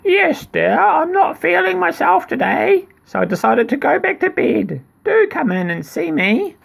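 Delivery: very high mock granny voice; wolf sweetly faking grandma; wolf as old lady. Wolf answering the girl in high mock granny voice.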